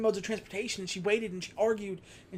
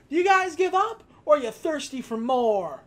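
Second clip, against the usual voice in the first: high, louder hammy taunt; mock taunting kid; 6 semitones higher, 5 dB louder than usual. Kid taunting the burglars, hammed-up taunt. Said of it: "where he's like".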